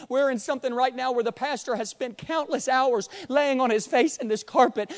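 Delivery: ordinary delivery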